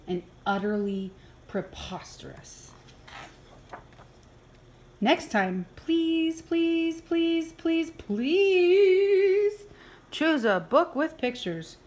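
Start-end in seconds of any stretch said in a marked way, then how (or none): none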